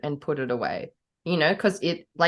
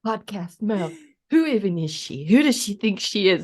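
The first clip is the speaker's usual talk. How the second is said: lightly put-on American gloom; a touch of mopey American; gloomy American accent. Mocking their own podcast self, gloomy American. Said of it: "my gloomy voice is American".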